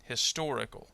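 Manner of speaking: "Historical." with the stress kept on "tor"; stress on second syllable. Shown as a demonstration. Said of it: "it doesn't change".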